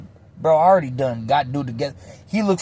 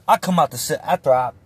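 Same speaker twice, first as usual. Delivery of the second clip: ordinary talk, nothing marked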